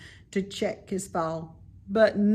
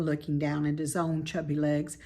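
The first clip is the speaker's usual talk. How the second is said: usual speaking voice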